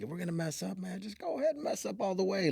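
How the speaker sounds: rally the team, loose and urging; coach pep talk. Retold or imitated. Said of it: "I tell my athletes".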